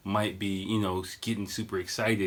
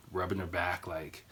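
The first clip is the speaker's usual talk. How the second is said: ordinary delivery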